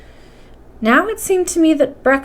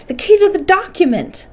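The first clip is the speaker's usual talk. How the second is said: cried out; 9 semitones higher than usual. Acted. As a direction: press the point with a raised cry. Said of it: "I cried".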